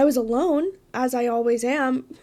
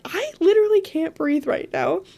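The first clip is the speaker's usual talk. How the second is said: mock panicked whine, a bit higher; Ron; 5 semitones higher, 4 dB louder than usual. The panicking part of her, lightly voiced as a scared boy. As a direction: play the frightened friend who can't cope. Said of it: "the Ron part of me that was like".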